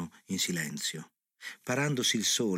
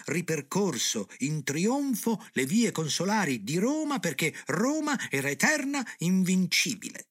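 high-pitched orator's proclamation, louder at the peaks; vocetta acuta; 9 semitones higher, 3 dB louder than usual. A narrator's reading. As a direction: declaim it high like a balcony speech. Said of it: "con la sua vocetta acuta". (vocetta acuta da comizio, declamata)

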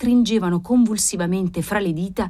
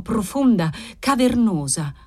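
ordinary delivery

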